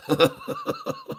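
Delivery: real laugh after the punchline; squeaky burst of laughter; high squeal of laughter. Real, not acted.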